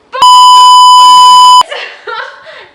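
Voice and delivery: a shrieking, high burst of laughter; piercing incredulous cackle; loud laugh. Real, not acted. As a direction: explode into disbelieving laughter. Mocking his claim.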